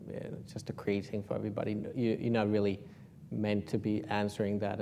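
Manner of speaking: ordinary delivery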